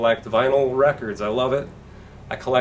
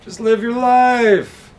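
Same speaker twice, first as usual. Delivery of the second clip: much higher, louder, slow sing-song; airy dismissal; 13 semitones higher, 8 dB louder, much slower than usual. Retold or imitated. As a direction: float it out breezily. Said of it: "And I'm like".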